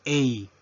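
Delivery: letter A pronounced "ey"; English letter A. Shown as a demonstration. Teacher modelling the English alphabet, A as "ey". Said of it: "pronunciamos la letra A en inglés".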